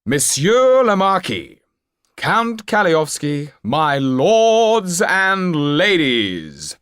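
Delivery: a flamboyant stage magician working the crowd; showman magician. Acted. Topolain announcing to the nobles, high and loud showman voice.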